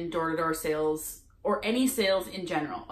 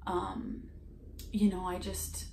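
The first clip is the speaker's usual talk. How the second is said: softer, hushed saleswoman apologizing; quiet apology; 8 dB quieter than usual. Retold or imitated. Vlogger imitating the saleswoman's quieted apology at the door. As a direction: drop to a meek, sorry hush. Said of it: "her voice got quiet".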